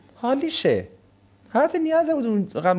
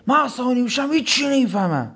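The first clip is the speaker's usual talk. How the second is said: mock hothead; 5 semitones higher than usual. Mimicking the hothead's excuse.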